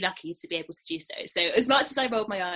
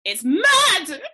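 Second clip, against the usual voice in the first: excited; 7 semitones higher, 11 dB louder than usual. Real, not acted. Raving about Tiger King.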